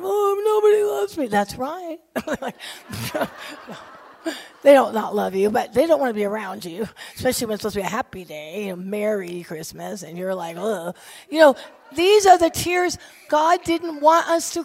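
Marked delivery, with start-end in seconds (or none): 0.0-1.3 high, whiny pity-me wail, hammed up; hammed-up whine that nobody loves me; mock self-pity. Mocking self-pitying churchgoer. 10.6-11.0 mock disgusted ugh. Mocking sulky reaction.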